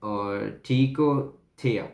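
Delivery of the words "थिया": थिया said as थी with a short ए sliding after; letter pronunciation. Shown as a demonstration. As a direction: say थिया like थी plus a brief falling ए. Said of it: "जैसे हम थी बोलते हैं लेकिन बस ए हटा दें".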